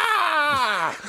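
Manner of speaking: high yelped stab cry; a sudden high lunging yell. Retold or imitated. Eddie lunging at Felix with a fork, high yell. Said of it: "he goes to stab Felix".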